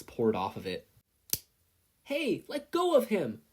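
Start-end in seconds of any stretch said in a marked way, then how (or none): 2.0-3.4 put-on much higher, louder boyish yell. A narrator's reading. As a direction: shout to stop the man. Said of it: "Katsuki yelled".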